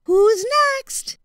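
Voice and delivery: put-on high, courteous young voice; boy's high voice. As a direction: politely turn the crocodile down, voiced high.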